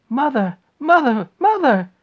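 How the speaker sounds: loud, high calls, rising each time; shouting. Acted. Charlie bursting in to find his mother, loud high calls.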